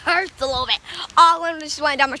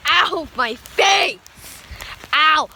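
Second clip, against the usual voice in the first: usual speaking voice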